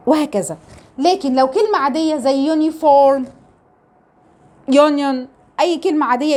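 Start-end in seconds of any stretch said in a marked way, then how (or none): 2.5-5.3 "يونيفورم، يونيون" opening on a clear "yoo". Shown as a demonstration. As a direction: start each of "يونيفورم، يونيون" with the long "yoo" sound. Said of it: "فبنطقها يو عادي جدًا". (نطق "يونيفورم، يونيون" بصوت "يو")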